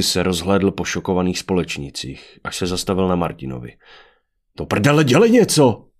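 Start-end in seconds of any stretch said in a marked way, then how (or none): 4.6-5.9 loud, high-pitched frantic demand; panicked shout. A narrator's reading. Adrian turns on Martin in terror, shouting. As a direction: yell for someone to act.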